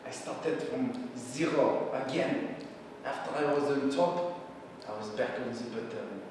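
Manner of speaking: low, soft and defeated; dejected. Acted. Louis back on the bottom, acted dejection. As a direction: fade away in resignation, with pauses.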